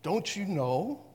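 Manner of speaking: sarcastic. Shown as a demonstration. Paul chiding the Roman believers, sarcastic and slow. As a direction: let the sarcasm drip as if it's obvious. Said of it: "a little bit on the sarcastic side".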